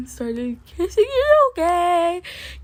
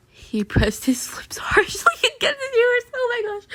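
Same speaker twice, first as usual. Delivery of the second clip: giggling through the words, higher; breaking into giggles mid-sentence; flustered laughing read; laughing while reading; 9 semitones higher than usual. Real, not acted. Flustered reader reading kiss scene.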